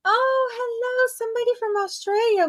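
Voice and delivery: light up at a surprise guest; delighted surprise. Real, not acted. Surprised at viewer from Australia.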